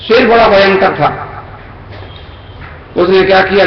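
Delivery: ordinary delivery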